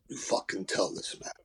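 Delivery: hushed, low and secretive; conspiratorial whisper. Retold or imitated. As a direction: whisper it like passing on a dirty secret. Drunk co-star confiding a wind-up.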